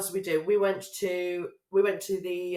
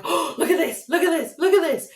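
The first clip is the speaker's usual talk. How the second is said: higher, louder, eager look at this; excited pointing; 9 semitones higher, 7 dB louder than usual. Retold or imitated. Tourists in Amsterdam excitedly spotting everything to look at. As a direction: gasp at every shop window.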